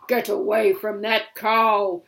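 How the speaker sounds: loud giant roar; giant's threat. Acted. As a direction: bellow it possessively. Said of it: "he said".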